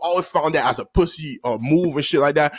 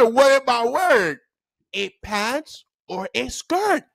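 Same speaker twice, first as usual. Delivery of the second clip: slow, raised-pitch interrogation; stern teacher; 5 semitones higher, slower than usual. Retold or imitated. Teacher demands a crying pupil say what he wears, stern. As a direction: spell out the choice as if to a child.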